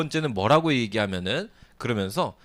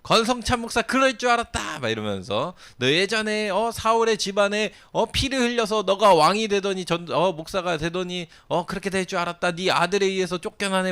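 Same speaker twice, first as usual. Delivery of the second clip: high, gloating accusation; gloating taunt; 7 semitones higher than usual. Retold or imitated. Shimei figure gloating at the ousted king, high taunt. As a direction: rub his downfall in his face. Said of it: "막 이러면서 이런 거예요".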